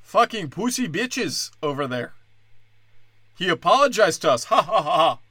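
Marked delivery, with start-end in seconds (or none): 0.0-2.1 high, jeering mock Iranian accent; gloating caricatured Iranian accent. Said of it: "they're all like". 4.5-5.2 forced, spelled-out gloating laugh; hammed-up "ha ha" cackle; mock gloating laugh. Iranians gloat over apology.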